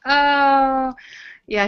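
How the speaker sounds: usual speaking voice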